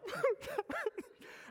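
very high hammed-up wailing sob; a high, comic boo-hoo cry; mock wail. Mock sobbing over dog movie.

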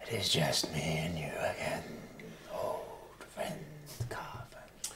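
breathy whisper from the mist; ghostly whisper. Acted. Spirit voice on the wind.

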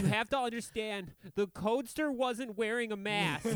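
high, mock-earnest courtroom plea; hammed-up righteous testimony, pitched well up; indignant defendant. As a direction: plead the case in a high, outraged voice.

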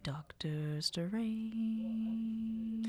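breathy whispered name, very soft and slow. Acted. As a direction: whisper it like a secret. Whispered start of improvised intro.

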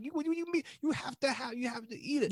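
high Italian-American pushy voice; Viggo Mortensen impression. S1 imitating Tony forcing chicken, high Italian-American accent. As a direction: insist like an Italian-American bully. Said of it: "that's literally what he sounds".

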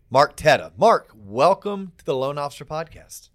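booming showman announcer; ringmaster. Acted. Podcast intro announcer.

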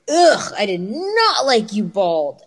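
slow, drawn-out mock disgust; imitated disgust. Young son telling mom his verdict, mock disgusted. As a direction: imitate a kid's drawn-out ugh. Son's blunt disgusted answer acted.

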